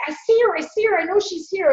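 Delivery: high, agitated imitation of the uncle; wobbly, shaking voice. Grieving uncle insisting his late wife is here, imitated high and wobbly. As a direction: raise the pitch and insist, as a shaken old man would. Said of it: "His voice was wobbly".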